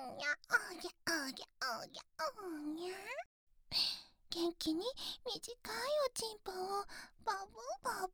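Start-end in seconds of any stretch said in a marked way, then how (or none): 0.0-2.9 whiny infant wail; baby crying sounds. Acted. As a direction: mewl like a newborn. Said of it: "baby cry imitation".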